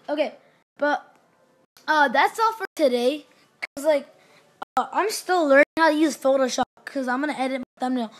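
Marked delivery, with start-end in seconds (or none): none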